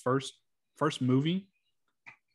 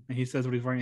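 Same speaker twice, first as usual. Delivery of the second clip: usual speaking voice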